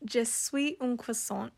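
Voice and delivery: "Je suis un croissant" said slowly and carefully, American French; halting French. Retold or imitated. Ordering at a Paris bakery in proud American French. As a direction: sound out "Je suis un croissant" with shaky schoolbook French. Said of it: "and I would say".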